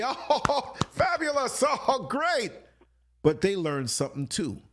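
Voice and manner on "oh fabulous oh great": high, sarcastic gushing; sing-song mock praise; mock delight. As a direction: fake enthusiasm, way up high. Mocking gossip reaction.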